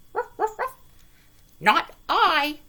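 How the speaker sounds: high yappy barks then a loud refusal; a little dog saying no. A narrator's reading. Dog refusing the hen, loud yappy. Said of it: "said the dog".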